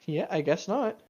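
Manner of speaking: usual speaking voice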